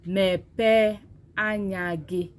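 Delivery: mepe anyagi said very slowly, each syllable clear; teacher sounding out mepe anyagi; slow teaching demo. Shown as a demonstration. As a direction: spell out mepe anyagi slowly for the class to repeat. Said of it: "Read it with me".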